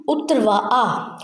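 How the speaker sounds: उतरवा आ said split apart, slow; slow wrong pronunciation. Shown as a demonstration. Teacher shows the class how not to say it, slow. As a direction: drag उतरवा आ as the wrong form. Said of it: "अगर मैं बोलूँ".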